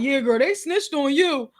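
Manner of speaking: lightly voiced gossiping coworker; snitching coworker. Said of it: "they be like".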